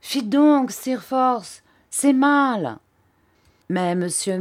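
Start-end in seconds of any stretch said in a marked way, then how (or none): 0.0-2.8 high, loud protest; reproachful exclamation. A narrator's reading. Schoolboy scolding his friend, reproachful cry. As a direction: cry shame on him, voice lifted high. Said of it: "s'écria".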